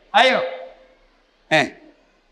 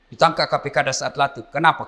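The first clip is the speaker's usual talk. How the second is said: normal talking voice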